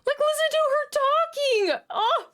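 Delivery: very high, squealed delight at her accent; gushing squeal. Real, not acted. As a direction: squeal it, totally smitten with the accent. Gushing over the clip speaker's accent.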